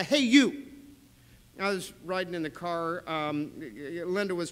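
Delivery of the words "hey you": a loud, higher Hey, you across a distance; calling out. Shown as a demonstration. Preacher showing Jesus calling Simon like a shout, yelling. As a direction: call someone over sharply. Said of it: "But it's a".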